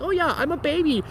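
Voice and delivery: high mocking baby-talk; sneering, babyish game voice; mocking the game's hand-holding. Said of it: "it's like".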